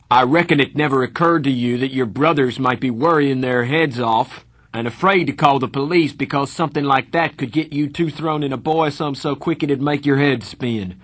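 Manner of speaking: loud, high furious scolding; angry lecture. A narrator's reading. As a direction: tear into him. Darry scolding Pony.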